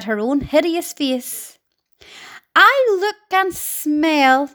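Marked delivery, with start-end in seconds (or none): 2.6-4.5 higher, slow and preening; vain Aunt Sponge. Acted. Aunt admiring herself in her mirror, high and slow. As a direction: savour each word like a vain old aunt. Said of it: "Aunt Sponge declared".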